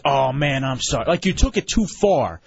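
ordinary talk

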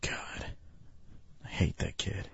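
under-the-breath whisper. Real, not acted. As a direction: mutter it to yourself after the call. Host mutters after hanging up.

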